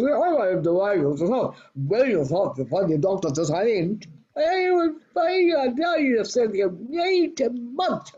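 high, slow, garbled mumble; imitating an unintelligible old don; mumbling impression of Berlin. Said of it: "completely unintelligible".

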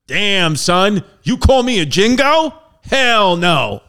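high, loud indignant yell, slow on the jingo line; offended outburst. Retold or imitated. President insulted by ambassador, loud indignant outburst. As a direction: snap back like a man just insulted.

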